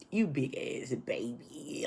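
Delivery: slow, teasing whisper to oneself; mocking whisper. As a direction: whisper it slowly, making fun of yourself. Mocking herself in an aside.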